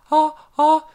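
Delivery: high rising ah ah gasps; building pre-sneeze gasps. A narrator's reading. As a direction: gasp toward a sneeze. Woman about to sneeze.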